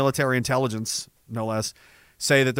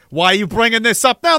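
higher and louder, sharp irritation; exasperated yelling; 7 semitones higher, 5 dB louder than usual. Real, not acted. Streamer losing patience with a chatter, shouting angrily. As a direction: blow up at the question. Streamer ranting at a super chat.

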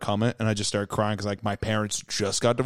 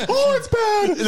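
very high, laughing fake-cry squeal; mock sobbing through laughter; 3 dB louder, much slower than usual. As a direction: wail it out while cracking up. Imitating crying on phone.

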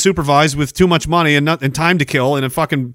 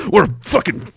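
usual speaking voice

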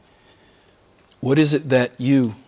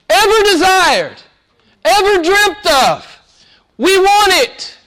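high, loud, drawn-out preaching cry; full-force preaching; 14 semitones higher, 7 dB louder than usual. Acted. Preacher crying out the disciples' longing to the church, high and loud. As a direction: lift the congregation, stretching each phrase.

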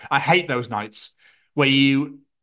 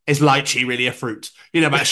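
ordinary delivery

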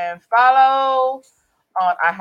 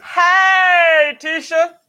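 calling out name; 7 semitones higher, 3 dB louder, much slower than usual. Real, not acted. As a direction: shout the name across. Guest calls out to viewer.

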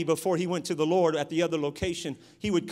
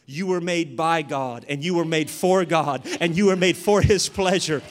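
full-force preaching; 2 semitones higher, 8 dB louder than usual. Acted. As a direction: drive every phrase home and peak in a shout.

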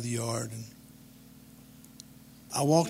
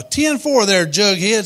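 high, loud voice of the neighbor lady; hollered CB reply; 6 semitones higher, 9 dB louder, slower than usual. Acted. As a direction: holler it out in CB lingo.

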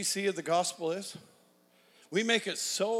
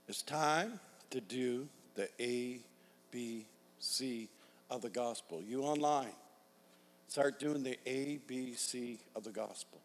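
quiet intense appeal; 5 semitones lower, 8 dB quieter than usual. Acted. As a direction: pull it down to an urgent hush. Intense altar-call appeal.

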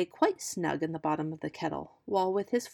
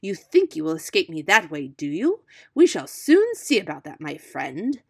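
menacing fox; 7 dB louder than usual. A narrator's reading. Fox vows to catch pig.

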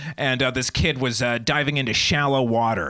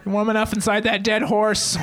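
high-pitched motherly call; hammed-up mom hollering a check-in; fussing mom; 9 semitones higher than usual. Mom popping up over a snowbank, fussing. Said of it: "my mom would just pop up".

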